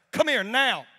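angry TSA agent ordering him over; stern command. Retold or imitated. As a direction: demand he come over. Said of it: "she was like".

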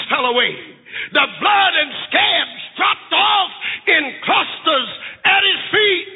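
high, impassioned preacher's cry; fiery preaching. Acted. Preacher to congregation, fervent high preaching. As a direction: preach it with a catch in the voice.

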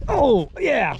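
high-pitched triumphant cry; excited whoop. Real, not acted. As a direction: exclaim high as the fish hits. Fish on after a good cast.

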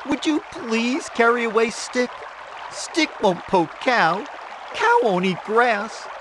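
high rooster pleading, rising at the end. A narrator's reading. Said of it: "he asked".

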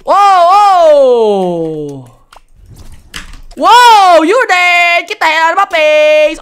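loud, high gloating shout; triumphant yell. Real, not acted. Gamer gloating loudly at the enemy he killed. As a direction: bellow it over the kill.

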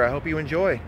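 usual speaking voice

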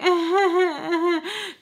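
high, put-on giggle; fake, high-pitched polite laugh; forced laugh. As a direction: imitate laughing along, trying too hard. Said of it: "trying to, like, laugh with him".

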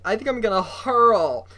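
loud, high-pitched complaint; distressed cry. A narrator's reading. Bitten boy announces he feels sick, distressed.

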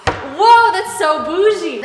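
high, loud mock-amazed whoa, drawn out; amazed reaction. Tenant retelling her reaction to the landlord's demonstration, amazed. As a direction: retell being blown away, voice leaping up.

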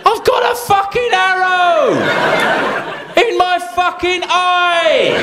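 King Harold shouting down a prim nobleman; outraged king shout. Acted. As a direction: bellow back in outrage.

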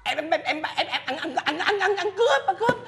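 high, rattled stuttering; panicked stammer. Acted. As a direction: stammer it out, pitch climbing high. Rattled caller stammers under scolding. (lắp bắp hoảng hốt, giọng vút cao)

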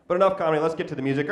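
ordinary talk

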